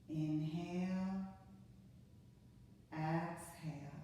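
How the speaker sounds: slow, soft, drawn-out breath cues; guided breathing cues. Acted. Yoga teacher counting a class through five breaths, slow and soft. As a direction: ease them through each breath, unhurried.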